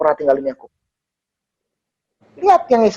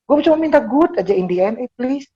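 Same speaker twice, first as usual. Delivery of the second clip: pleading; 6 semitones higher than usual. Retold or imitated. Retold pleading with God.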